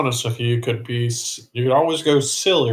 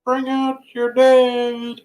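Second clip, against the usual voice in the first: high, slow calling out; plague cart call; 12 semitones higher, 3 dB louder, much slower than usual. Retold or imitated. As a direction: cry it out like a plague collector. Plague cart call, Monty Python.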